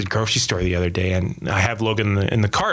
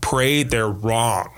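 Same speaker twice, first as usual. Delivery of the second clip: slow, each word weighted; slow, weighted plea; much slower than usual. Retold or imitated. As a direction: plead it slowly and firmly. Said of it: "And that's my big thing".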